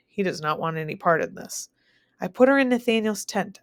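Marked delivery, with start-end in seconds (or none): none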